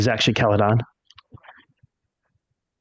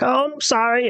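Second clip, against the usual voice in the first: put-on high little old lady apology; 13 semitones higher than usual. Old lady herding geese apologizes to the travellers, high and meek. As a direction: squeak it out like a frail granny. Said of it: "the old lady's like".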